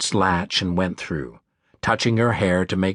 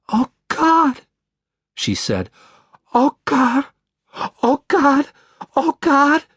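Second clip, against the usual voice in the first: high, louder, breaking with a cry; horrified; 5 dB louder, slower than usual. Acted. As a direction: panic cracking the voice. Sees injured man.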